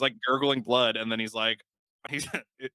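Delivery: ordinary delivery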